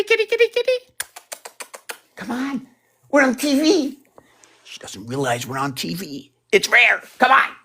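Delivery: very high squeaky coaxing. Real, not acted. Luring the cat to come chat, very high squeaky baby talk.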